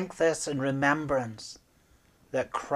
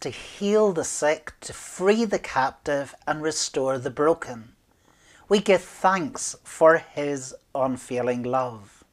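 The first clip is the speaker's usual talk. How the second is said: ordinary delivery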